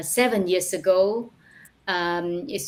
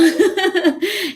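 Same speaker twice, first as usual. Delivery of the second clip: light laugh; 12 semitones higher, 6 dB louder than usual. Real, not acted. Laughs about her past school.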